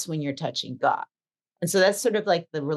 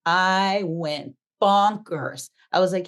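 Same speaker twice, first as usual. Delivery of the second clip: normal talking voice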